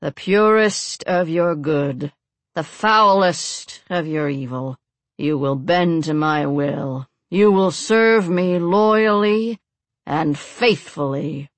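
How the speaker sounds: slow, measured ritual intoning; solemn. A narrator's reading. Priest binding the captives to his will, solemnly. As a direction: intone a binding spell, drawn out. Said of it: "the priest said solemnly".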